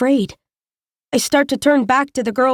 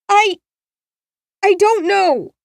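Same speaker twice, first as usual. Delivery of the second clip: put-on high, hesitant frightened girl; a lost girl stammering; scared girl; 9 semitones higher, 3 dB louder than usual. A narrator's reading. Girl voiced in story.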